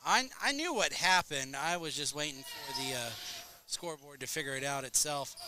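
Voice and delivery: ordinary talk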